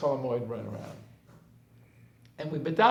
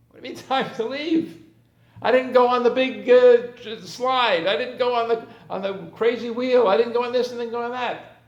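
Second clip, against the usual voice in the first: put-on high, loud whining children protesting; whiny kids; 7 semitones higher, 8 dB louder than usual. Kids to father at the park, high loud whining. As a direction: complain like a child who missed the big rides.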